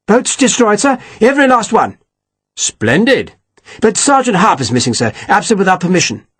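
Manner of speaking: a hint of a fussy captain reporting; Slingsby's voice. A narrator's reading. Captain reporting to his colonel, lightly voiced stiff officer.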